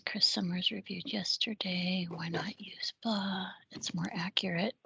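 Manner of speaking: reading aloud under breath; whispering. Real, not acted. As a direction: murmur it to yourself, voiceless. Reading review comments under breath.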